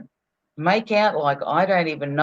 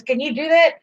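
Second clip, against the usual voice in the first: raised, amused imitation of the shopkeeper; quoting the fruit and veg guy, higher and tickled; imitating the grocer; 9 semitones higher than usual. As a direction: play the fruit seller chuckling along. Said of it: "He goes".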